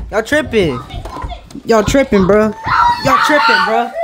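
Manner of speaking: from firm to full yell; building yell. Real, not acted. Yelling at kids hiding.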